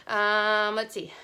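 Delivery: slightly out of breath mid-workout. Real, not acted. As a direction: talk while winded from exercise. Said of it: "Whew, I'm getting out of breath".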